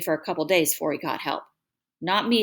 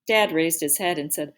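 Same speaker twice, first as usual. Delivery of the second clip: ordinary delivery, nothing marked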